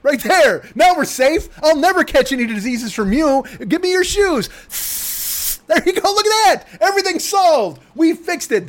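high, chirpy put-on voice; hammed-up high chipper voice; mock salon worker. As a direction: play the cheerful salon worker. Mock pedicure salon talk.

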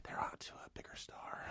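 voiceless, very soft retold line; whispered quote. Casting people calling the actor in his car, whispered. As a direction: drop right down to a whisper. Retold phone call from producers.

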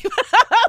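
bursting into a high loud laugh; real cackle of laughter. Real, not acted. Laughing at own joke with co-host, loud laugh.

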